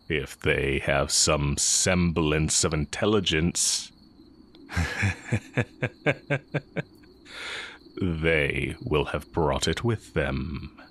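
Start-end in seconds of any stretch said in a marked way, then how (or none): 4.7-6.8 a short, amused chuckle with a rising end; scornful chuckle. Acted. The teacher scoffs at the Inquisition with a rising chuckle. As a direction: chuckle at how dim they are.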